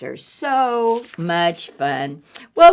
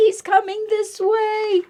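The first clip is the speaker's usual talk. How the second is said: put-on scared boy; 12 semitones higher than usual. Eddie scared.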